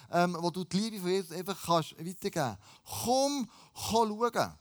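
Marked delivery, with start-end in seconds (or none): 2.8-4.5 high, emphatic beckoning; calling out. Acted. Preacher urges sharing Jesus' love, calling out. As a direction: call it in two separate bursts. Invitation called out again.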